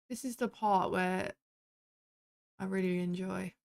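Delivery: dry, fake enthusiasm on really; sarcastic. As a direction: say it like you mean the opposite. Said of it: "That was sarcasm".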